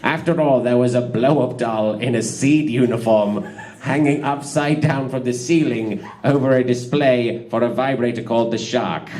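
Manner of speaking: usual speaking voice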